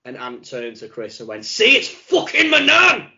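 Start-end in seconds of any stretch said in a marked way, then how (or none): 1.4-3.1 very high, loud, drawn-out yell; retold exclamation. Imitating Ant turning to Chris, shouted outburst. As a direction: shout it slowly, pitched right up, as an imitation.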